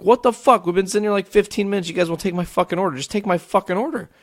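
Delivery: high-pitched exasperated complaint, retold; livid rant. Fed-up customer ranting at the drive-thru, livid. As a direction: vent like a fed-up customer stuck waiting. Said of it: "I'm getting livid".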